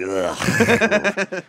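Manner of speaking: high-pitched, half-laughing "ugh"; mock disgusted groan. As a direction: groan like a cringing comic at the back. Imitating comics groaning at back of room.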